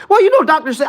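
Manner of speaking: high, loud, whiny mock; mocking dumb voice. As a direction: parrot them like an idiot. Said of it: "I'm like".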